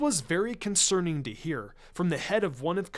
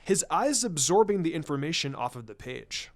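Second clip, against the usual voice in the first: usual speaking voice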